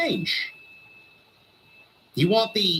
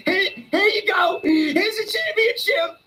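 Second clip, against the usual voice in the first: mock yell; 10 semitones higher than usual. Fan mocks the company gifting titles, mock yell. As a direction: shove the belt at her, yelling.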